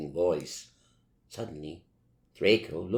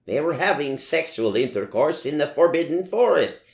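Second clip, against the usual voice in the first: higher, louder furious report; furious yell; 8 semitones higher, 6 dB louder than usual. A narrator's reading. Dumbledore telling the professors, furious yell. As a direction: yell it out in fury. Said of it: "he yelled in a furious voice".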